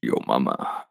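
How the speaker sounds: deep, soft sultry crooning; sultry narrator. Shown as a demonstration. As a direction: purr it out low and seductive. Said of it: "care to give us a sample".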